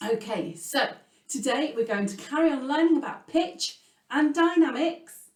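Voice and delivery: ordinary delivery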